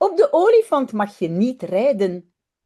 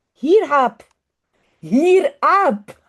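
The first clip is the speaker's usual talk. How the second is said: a high, louder call to the monkey; child calling out; 3 semitones higher, 6 dB louder, much slower than usual. Acted. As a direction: Jip calling the ape across the rocks. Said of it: "roept Jip".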